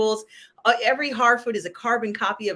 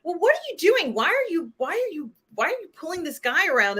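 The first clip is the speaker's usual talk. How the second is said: higher mock-incredulous friend voice; a lightly higher voice for the friend's question; quoting her friend; 8 semitones higher than usual. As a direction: play the baffled friend up high. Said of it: "when her friend says".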